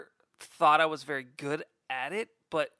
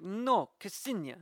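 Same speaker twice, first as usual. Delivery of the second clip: slow, firm "Ksenia" with a hard K, higher; impression of Ksenia; 6 semitones higher, slower than usual. Ksenia correcting co-host's pronunciation, slow and firm. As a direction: correct the name firmly and slowly. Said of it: "terrible impression of, of Ksenia".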